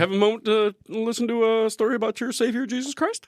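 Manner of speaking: high, sweet door-knocker pitch; hammed-up preacher at the door; mock missionary. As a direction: sell salvation like a cheery evangelist.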